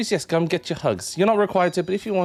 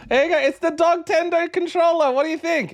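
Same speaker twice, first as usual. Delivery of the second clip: excited pet talk; 13 semitones higher than usual. Real, not acted. Showing a squeaky toy to her dog.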